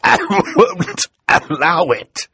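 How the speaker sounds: high, loud, strained gasp; gasped protest. Acted. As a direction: gasp it out slowly after coughing. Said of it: "Mister Poe finally gasped".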